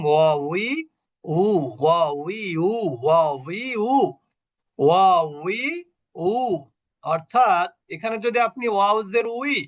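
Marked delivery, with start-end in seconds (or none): 0.1-6.3 rounded ওয়া, উই, উ with the lips pushed forward; Arabic waw drill. Shown as a demonstration. Teacher drilling students on Arabic waw sounds. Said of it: "ওয়াওটাকে আমরা একটু আলাদাভাবে practice করেছিলাম".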